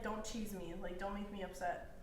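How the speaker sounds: ordinary talk, nothing marked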